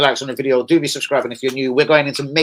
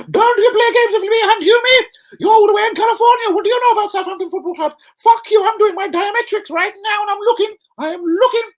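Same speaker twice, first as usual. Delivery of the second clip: mock unhinged manager; 5 dB louder than usual. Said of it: "He's flicking straight over the edge".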